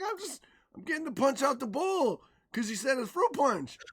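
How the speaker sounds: high-pitched retold kid excuse; child's protest. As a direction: plead innocence as a kid to mom. Child defends himself to his mom.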